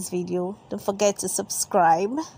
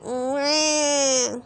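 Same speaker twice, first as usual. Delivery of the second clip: hammed-up baby wail; little brother George bursting into tears, mock wail; mock crying; 4 semitones higher than usual. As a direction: cry like a toddler, wah wah. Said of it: "George bursts into tears".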